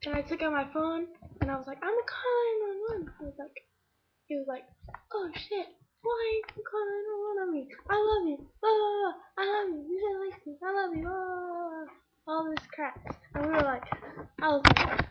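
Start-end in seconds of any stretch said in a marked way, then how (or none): 1.8-3.1 high-pitched acted warning; panicky retold threat. Girl with phone warning her pursuer, high and panicky. 5.1-11.9 high wailing imitation, fading at the end; mock whiny pleading. Stalker pleading with the girl who phones police, mock wailing.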